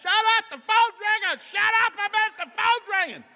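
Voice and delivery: very high, loud mock yell; hammed-up frantic shout at the family; yelling husband. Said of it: "He'll start yelling".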